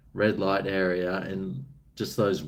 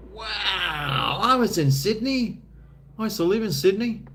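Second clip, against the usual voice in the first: excited; 9 semitones higher than usual. Real, not acted. Excited stranger hearing about Sydney.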